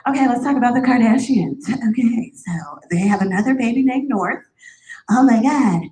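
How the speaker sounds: breezy host voice dropping to a whisper; mock radio cheer. On the radio, hiding pain with mock breezy chatter. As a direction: fake it like all is good.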